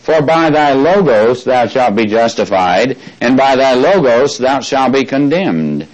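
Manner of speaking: "logos" set apart and leaned on; stress on logos. Shown as a demonstration. Teacher rereading the verse, leaning on "logos". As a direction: land on "logos" each time as the key word. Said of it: "Let's do it again".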